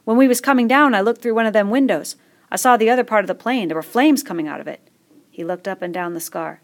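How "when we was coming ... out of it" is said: put-on higher, louder excited boy telling the crash; lightly voiced fat boy, eager. A narrator's reading.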